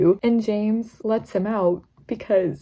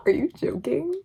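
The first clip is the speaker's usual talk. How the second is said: high, laughing disbelief; can't believe what she just read; 8 semitones higher than usual. Real, not acted. Reader gushing over the kissing quote, high and incredulous.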